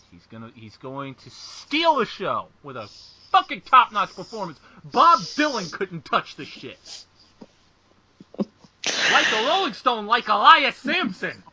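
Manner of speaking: loud, emphatic fan raving; hammed-up hype. Co-host re-enacting hyping a singing wrestler to their girlfriend, exaggerated raving.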